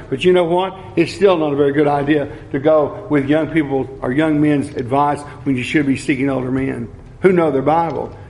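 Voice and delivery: ordinary talk, nothing marked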